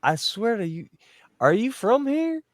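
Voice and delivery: put-on Southern accent. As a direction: imitate a Southern lady asking where you're from. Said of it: "they'd be like".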